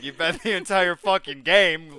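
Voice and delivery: loud, high excited rant; laughing mock outrage. Real, not acted. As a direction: blurt it out, half laughing.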